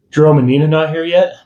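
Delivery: Hank's voice. Acted. Hank asking about missing friends.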